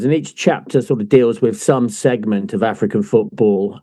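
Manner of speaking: usual speaking voice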